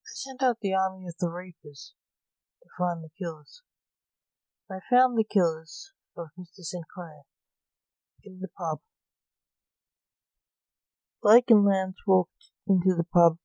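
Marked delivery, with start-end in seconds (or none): none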